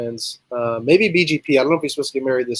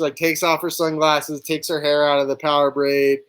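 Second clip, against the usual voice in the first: ordinary delivery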